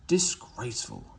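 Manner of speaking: hissed under the breath, falling; grumbling engine hissing to himself. Acted. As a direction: spit the word out in disgust. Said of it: "he hissed".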